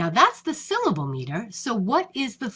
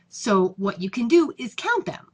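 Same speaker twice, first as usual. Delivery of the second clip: ordinary delivery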